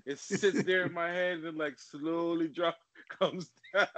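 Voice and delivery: talking through real laughter; words broken up by laughing. Real, not acted. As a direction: crack up while telling it. Laughing at his own hair.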